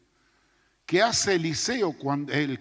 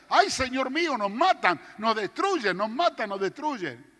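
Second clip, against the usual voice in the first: panicked wail; 6 semitones higher than usual. Retold or imitated. Frightened servant wailing in the yard to the Lord. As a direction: cry out that they will all be killed. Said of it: "hablando a los gritos".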